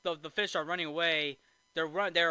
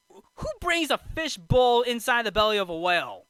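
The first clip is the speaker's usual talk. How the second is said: loud, higher, exasperated question; comic disbelief, voice raised; incredulous rant; 6 semitones higher, 8 dB louder than usual. Real, not acted. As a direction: exclaim at the absurdity of it. Ranting at absurd plot detail.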